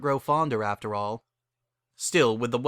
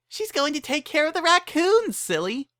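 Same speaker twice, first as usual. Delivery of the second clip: much higher, bouncy and cheerful; giddy high pony voice; bubbly Pinky voice. A narrator's reading. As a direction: chirp it like an excited party pony. Pinky answers Pokey.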